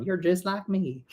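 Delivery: playful put-on Southern accent; fake Southern accent. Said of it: "that fake Southern accent".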